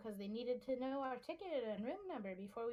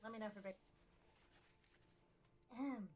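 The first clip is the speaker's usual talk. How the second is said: ordinary delivery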